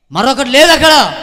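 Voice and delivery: sudden high, loud cry; loud declaration. Real, not acted. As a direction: slam the point home. (గట్టిగా ప్రకటించడం)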